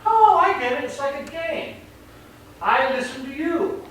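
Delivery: a dog that just got the game; dog's sudden realization. Retold or imitated. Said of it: "who's like".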